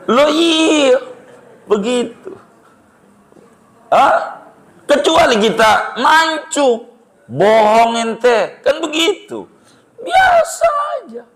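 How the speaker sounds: very high, comic retort; hammed-up high-pitched protest; mock comeback. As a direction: squeal back a playful comeback, slowly stretched. Acted cheeky comeback to the insult.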